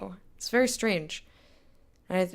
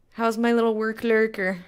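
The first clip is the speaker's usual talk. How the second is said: ordinary talk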